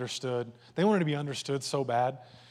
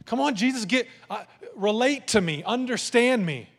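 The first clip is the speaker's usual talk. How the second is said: pleading; 7 semitones higher than usual. Acted. Acting disciples begging to be understood.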